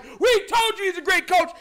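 high crowing fan quote; gloating fan. Retold or imitated. Coach's fans come out of hiding to boast, mock gloating. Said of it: "come out of hiding and be like".

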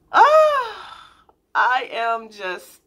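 real overjoyed rush of feeling; overwhelmed gasp. Real, not acted. Talking to viewers about her anniversary, overwhelmed with joy. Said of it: "I feel like crying".

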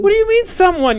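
high, loud affronted protest; incredulous, pitch leaping up; indignant disbelief. Acted. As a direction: react as if your impression were insulted. Imitation not recognised, he protests.

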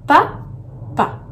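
first pa high and punched, then a pause; syllable by syllable. Shown as a demonstration. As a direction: count out pa, pa as two beats. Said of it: "deux syllabes".

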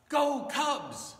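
high, loud fan yell; sports cheer. Acted. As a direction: cheer for your team from the stands. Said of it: "We cheer for that a lot".

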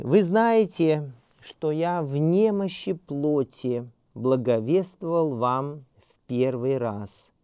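slow, solemn reading of the epistle, pitched a little up; scripture reading. Acted. Teacher reads Paul's letter to the class, slow and solemn. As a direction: read Paul's words slowly as holy text.

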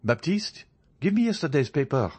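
high-pitched call to a servant; crying out. A narrator's reading. Journalist ordering his servant to bring the paper, crying out. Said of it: "cried Beauchamp".

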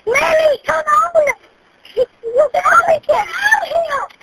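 high desperate screaming; panicked scream. Real, not acted. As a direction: scream for her to get out. Screaming at mother to leave fire.

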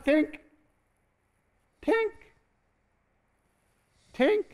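spaced high chisel taps; high chisel tinks. Retold or imitated. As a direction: tap each one out slowly and high. Chisel sound again.

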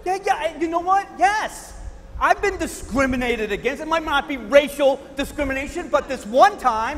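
high, loud, eager protest. Acted. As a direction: jump in, desperate to be a victim too.

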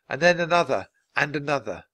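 ordinary delivery

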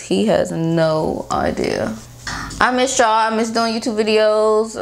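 face stiff under sheet mask; wearing a sheet face mask. Real, not acted. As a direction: mask edge touching lips. Seen 0: wet sheet mask covers face, mouth cut-out, edge near lips throughout.

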